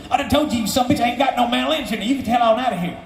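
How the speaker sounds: put-on high, fast cantankerous old-timer. Musician at the chained door cussing out the visitor, cantankerous. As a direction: rattle off a high-pitched telling-off.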